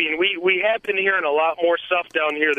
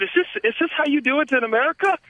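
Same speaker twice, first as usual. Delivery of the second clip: put-on self-confessed clumsy English accent, pitched higher and quick; a half-hearted stab at an English accent, quick and raised; bad English accent; 8 semitones higher, faster than usual. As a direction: fumble through a mock English accent. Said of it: "I can't do an English accent".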